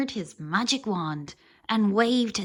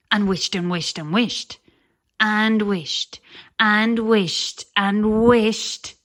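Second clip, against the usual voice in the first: louder and slowing as the wishing builds; building wish; 10 dB louder, slower than usual. A narrator's reading. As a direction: push harder with each wished. Wishing harder and harder.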